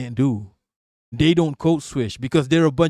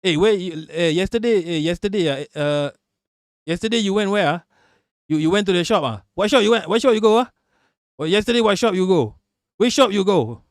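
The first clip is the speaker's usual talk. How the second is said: higher, quick-fire Malaysian English questioning; 4 semitones higher than usual. Shown as a demonstration. Asking a friend which shop they went to, Malaysian English accent. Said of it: "this how Malaysians speak English".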